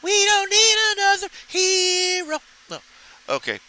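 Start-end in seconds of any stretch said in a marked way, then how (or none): 0.0-2.4 high, strained belting voice. Retold or imitated. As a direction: belt it like a rock diva.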